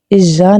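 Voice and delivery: ایجا with a buzzing j for y; wrong sound. Shown as a demonstration. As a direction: show the mistaken j in ایجا. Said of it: "نہیں بولنا".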